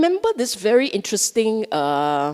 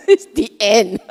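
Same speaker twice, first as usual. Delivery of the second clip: laughing; 7 semitones higher than usual. Real, not acted. Punchline after his own joke.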